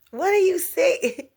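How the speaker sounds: confused listener. Retold or imitated.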